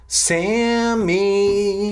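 hammed-up scream of the name; little brother screaming for Sammy, high and loud; mock scream. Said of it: "he screamed".

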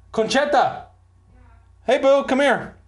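high-pitched mock holler; calling out. Narrator calling Conchetta over in an aside, high holler.

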